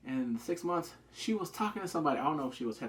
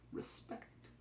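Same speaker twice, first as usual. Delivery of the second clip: whispered respect; 17 dB quieter than usual. Retold or imitated. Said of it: "and I was like".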